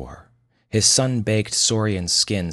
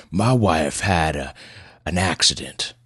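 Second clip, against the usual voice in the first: put-on slow, halting gruff old patient; gruff old man. Old widower tells doctor of his wife, gruff and halting. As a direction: drag it out haltingly in a rough country voice. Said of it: "Pleasanton's voice was as gruff as his appearance".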